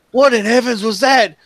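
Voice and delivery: high, loud startled outcry. Acted. Game master voices someone hearing the freeze, startled high cry. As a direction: play a shocked neighbor crying out. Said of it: "you hear this voice like".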